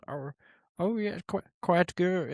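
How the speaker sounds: high, nasal-free put-on villain purr, pitched well up and hushed; Doctor Evil impression. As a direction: play a smug movie villain savoring a sip, voice lifted high. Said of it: "Oh, Doctor Evil".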